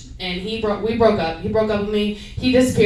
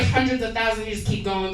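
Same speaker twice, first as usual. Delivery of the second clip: normal talking voice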